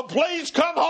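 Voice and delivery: high, urgent plea; begging children. Acted. Children to their mama, begging her home. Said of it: "those children beg their mama".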